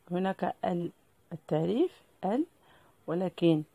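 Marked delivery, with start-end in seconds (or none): none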